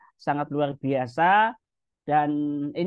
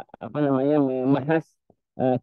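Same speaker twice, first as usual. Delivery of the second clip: usual speaking voice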